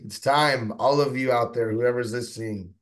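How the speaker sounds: rally the listeners with punch; rallying call. Real, not acted.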